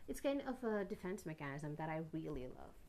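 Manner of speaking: ordinary talk, nothing marked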